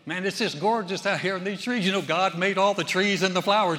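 loud, hammy mother pushing God; imitating mom turning every remark to God; preachy mom. Mother to her son among the trees, loud and preachy.

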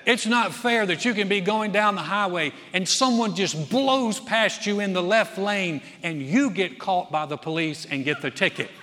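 usual speaking voice

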